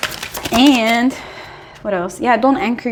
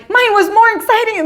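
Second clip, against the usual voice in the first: high, loud, boastful angel voice; squeaky smug little angel; bragging angel; 13 semitones higher, 6 dB louder than usual. Acted. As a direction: show off to the other angel.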